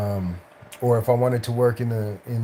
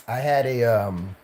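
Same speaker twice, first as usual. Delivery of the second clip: ordinary talk, nothing marked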